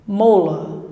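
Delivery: "mola" com final igual ao "a" fraco de "vida"; força no "mo", vogal final reduzida; final com schwa. Shown as a demonstration. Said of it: "Olha só o som". ("mola" como "MÔ-lâ")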